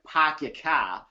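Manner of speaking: slow Boston accent with dropped r's; drawn-out Boston demo, r's dropped. Shown as a demonstration. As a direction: imitate a Boston accent, slowly. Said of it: "like, the Boston accent".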